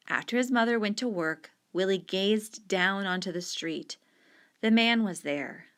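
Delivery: ordinary delivery, nothing marked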